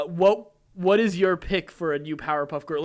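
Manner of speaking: ordinary talk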